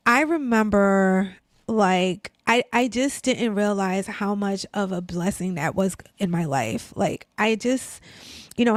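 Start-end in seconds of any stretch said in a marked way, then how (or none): none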